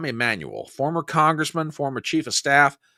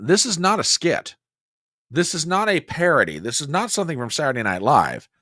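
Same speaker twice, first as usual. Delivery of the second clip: normal talking voice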